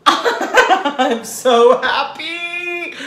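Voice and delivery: giddy laughing squeal. Real, not acted. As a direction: laugh it out, high and loud. Laughing giddy over batter.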